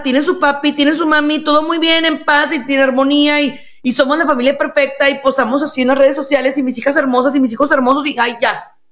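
high, sing-song boast of the perfect family; mock perfect mother. Therapist mocks a mother's picture-perfect family talk, high and gushing. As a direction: gush like a mother showing off online, pitched well up.

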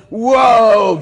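high, very loud drawn-out whoa; Benny Hinn. Retold or imitated. TV preacher felling a stadium crowd, big shouted whoa. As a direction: mimic a faith healer casting power over people. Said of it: "And he goes".

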